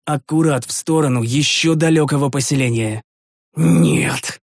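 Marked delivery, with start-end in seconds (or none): 3.6-4.4 a touch of an enraged growl; the hero, enraged, mildly growls a refusal; furious growl. Acted. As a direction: snarl it in fury. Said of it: "в ярости зарычал я".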